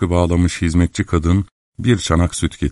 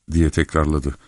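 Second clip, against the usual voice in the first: ordinary delivery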